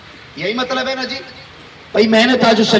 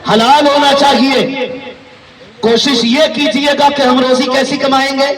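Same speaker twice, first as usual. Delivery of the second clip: booming political rally speech; 4 semitones higher than usual. Real, not acted. Preacher rousing the crowd.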